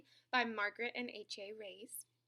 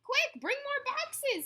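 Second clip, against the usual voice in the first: high, loud storybook shout; urgent yell; 9 dB louder, slower than usual. A narrator's reading. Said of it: "yelled a man".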